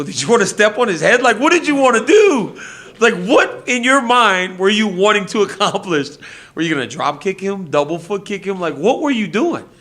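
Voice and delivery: loud, high-pitched incredulous rant; retold exasperated scolding. Retells scolding the fighter afterwards.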